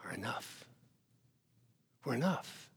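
breathy hushed whisper. Acted. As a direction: whisper it like a secret. Intimate conviction in sermon.

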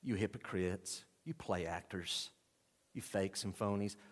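low, soft, stinging accusation; stinging rebuke. Acted. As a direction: deliver the rebuke quietly, pausing between insults. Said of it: "very stinging words".

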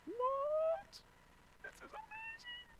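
tiny falsetto squeal; mock amazement. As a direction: act astonished in a very high, thin voice. Acting out amazement at God's gifts.

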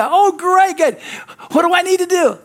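very high, loud and eager; hammed-up shout of relief; excited relief. Man on the branch answering heaven, excited relief.

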